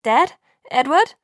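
loud call, rising high. A narrator's reading. Bella entering the house, loud call for Dad and Edward. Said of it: "rief ich".

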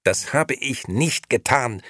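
slow, high, emphatic denial; defensive. A narrator's reading. Nino defends himself against his wife, defensively emphatic. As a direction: deny it firmly and loudly. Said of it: "verteidigte sich Nino".

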